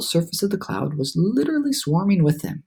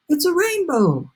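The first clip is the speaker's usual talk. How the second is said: much higher, delighted exclaim; excited cry; 11 semitones higher than usual. A narrator's reading. Travelers seeing the rainbow, delighted cry. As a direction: gasp it out in wonder. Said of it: "everyone said at once".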